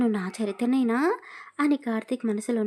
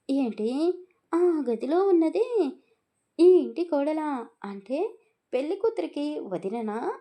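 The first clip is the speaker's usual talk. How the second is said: higher, louder, puzzled question; doubtful surprise; 6 semitones higher, 3 dB louder than usual. A narrator's reading. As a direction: ask in disbelief, raising the voice. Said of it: "Abhimanyu డౌట్గా అడిగాడు". (సందేహంగా ఆశ్చర్యంగా అడగడం)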